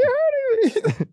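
very high, slow squeak; squeaky kid. Pretend. Nephew squeaks that the uncle's hug is hurting him. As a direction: squeak like a squeezed child.

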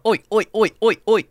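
high mock English "oi" chant; English gang chatter. Imitating a gang of Europeans nearing the blackjack table, mock English accent. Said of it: "that's what a gang of 'em sounds like".

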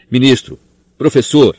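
annoyed. A narrator's reading. Nurse scolds the visitors, mildly annoyed. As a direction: scold them lightly. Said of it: "disse Madame Pomfrey aborrecida".

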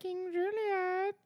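very high, slow lemur king; King Julien. Pretend. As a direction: stretch it out like a cartoon lemur. Cartoon lemur voice.

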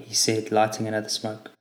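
ordinary delivery, nothing marked

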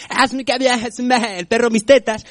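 high, stretched mock foreign gabble; hammed-up caricature of a ranting video message; Bin Laden. Comic imagines Bin Laden's next video, mock high-pitched rant. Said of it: "imagínate que se entera Bin Laden".

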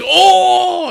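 big shocked high yell; shouted oh. Retold or imitated. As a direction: yell out a loud oh. Ty reacts to the taunt.

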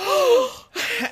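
loud, high theatrical gasp; mock shocked gasp. Host imitates Bitsy hearing Samantha's gone, hammed-up gasp. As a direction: gasp hugely as if scandalized.

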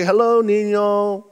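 slow, careful tourist Spanish, rising high on "Hola"; a lightly voiced, unsure greeting to a small boy; mock-sweet foreigner trying Spanish; awkward gringo Spanish. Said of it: "and I'm like".

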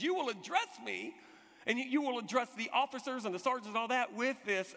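normal talking voice

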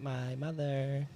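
slow high drawn-out; milk it slowly; mock dramatic. Comic dramatic answer.

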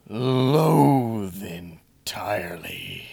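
slow breathy Grinch whisper; gasp, then breathe it out slowly. Retold or imitated. Quoting the Grinch whispering on the mountain. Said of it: "and he goes".

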